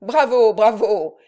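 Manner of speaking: loud cheering. A narrator's reading. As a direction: shout it like a tavern crowd. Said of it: "crièrent avec de grands éclats de rire".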